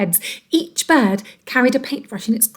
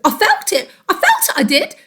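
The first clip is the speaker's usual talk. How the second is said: high indignant Mister Twit; 8 semitones higher than usual. Acted.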